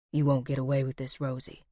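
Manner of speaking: low, quick, softened warning; low threat. A narrator's reading. Confronting Rosie at the standoff, low and quick. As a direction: warn in a low, quiet voice. Villain challenged at gunpoint.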